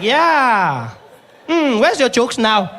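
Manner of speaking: high, slow, taunting; mock him slowly; taunting clerk.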